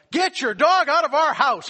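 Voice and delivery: high, loud shout from the kitchen; yelling. Retold or imitated. Son Ben yelling at the neighbor from inside, loud shout. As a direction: bellow it across the house. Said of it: "he yells out".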